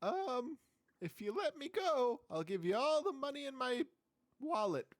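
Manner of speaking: high, wheedling little demon bargaining; squeaky imp trying to bribe; whiny imp voice. Acted.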